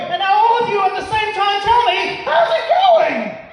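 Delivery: high-pitched hype call, stretched at the end; rousing crowd cue. Acted. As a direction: get a whole room to chant along. Cueing the whole audience to shout.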